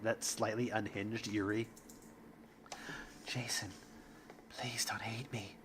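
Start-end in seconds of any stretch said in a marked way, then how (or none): 3.3-5.5 unvoiced pleading whisper; whispering plea. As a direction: beg softly under your breath. Yuri pleads.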